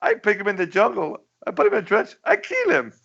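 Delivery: high, squeaky mock dictator, very matter-of-fact; cartoon Pol Pot voice. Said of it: "the way Pol Pot".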